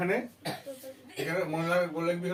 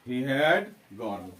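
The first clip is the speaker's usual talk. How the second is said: "He had gone." stretched right out, stress on "had"; slow teacher's model sentence; very slow model answer; 4 semitones lower, much slower than usual. Shown as a demonstration. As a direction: say "He had gone." very slowly so students catch each word. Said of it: "তাহলে এখানে কি হবে?".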